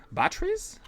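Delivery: sharp rising surprise; incredulous squeak; surprised high question. Real, not acted. Surprised at battery shortage.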